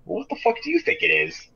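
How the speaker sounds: high-pitched mock retort. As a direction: imitate a scornful jerk, voice pushed way up. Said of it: "in the most mocking way possible".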